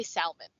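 salmon with a clear L sound, "sal-mon"; L pronounced in salmon. Shown as a demonstration. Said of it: "you pronounce the L in salmon".

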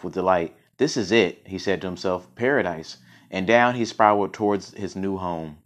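measured, expressive read-aloud; story narration. A narrator's reading. Reader telling a Buddha fable, expressive narration. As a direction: tell it like a fable, projecting the key words.

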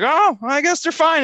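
put-on high, loud kid realization; imitated little kid. Little kid before jumping in the pool, imitated high voice. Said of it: "he's like".

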